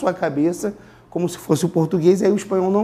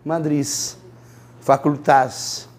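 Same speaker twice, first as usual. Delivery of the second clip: slow, lower madrileño ending; Madrid accent; 4 semitones lower, much slower than usual. Shown as a demonstration. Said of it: "os madrilenhos ... falam".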